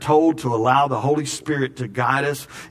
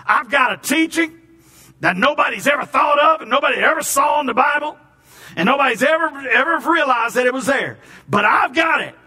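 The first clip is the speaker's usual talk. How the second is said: much higher, loud mock-shouting showman; boastful preacher; 11 semitones higher, 3 dB louder than usual. Preacher mocks a self-important pastor, loud and much higher. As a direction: ham up a bragging pastor. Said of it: "You ever heard that pastor say that?".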